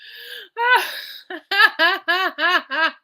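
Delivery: long high fit of laughter; laughter climbing high; real laugh. Real, not acted. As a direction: lose it laughing at the motto. Laughing at the ad motto.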